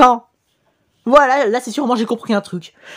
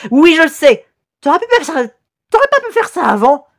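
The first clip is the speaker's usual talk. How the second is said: higher-pitched mock reproach; lightly voiced complaining viewer; 6 semitones higher than usual. Imagined viewer scolding the streamer over subtitles, higher mock reproach. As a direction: lightly act out a nagging viewer.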